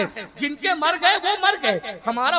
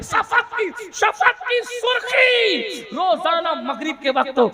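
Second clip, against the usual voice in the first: rhythmic proclamation, climbing to a high shout; building rousing refrain; 5 semitones higher, 4 dB louder than usual. Real, not acted. Preacher rousing the crowd with Hussain lives, building shout. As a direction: build each repetition higher and more forceful.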